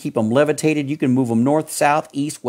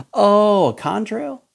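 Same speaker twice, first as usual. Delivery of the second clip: high, a hint of naive surprise; mock clueless reply; 10 semitones higher, slower than usual. Preacher imitating a beachgoer dismissing chemtrails, mildly mocking. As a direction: lightly play the oblivious beachgoer.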